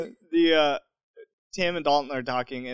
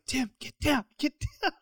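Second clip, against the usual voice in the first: high, hushed urgent order; hiss-free stage hush, pitched up; urgent hushed warning; 9 semitones higher, 7 dB quieter than usual. Retold or imitated. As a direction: quietly urge a friend to duck. Urging friend to hide.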